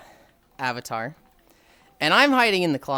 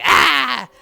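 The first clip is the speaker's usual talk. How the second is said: imitated attack yell; 7 semitones higher, 8 dB louder than usual. Attacker sprinting in.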